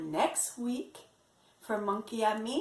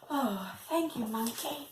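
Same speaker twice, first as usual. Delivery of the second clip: ordinary talk, nothing marked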